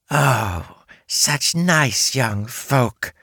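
slow, high delighted spider; enthusiastic. Acted. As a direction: gush over them. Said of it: "She seemed to shake with enthusiasm".

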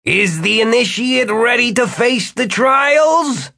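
high, slow ceremonial shout; portentous, stretched-out, high-pitched challenge; grand bellow. A narrator's reading. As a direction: bellow the question like a master of a rite. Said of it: "Jimmy's voice bellowed".

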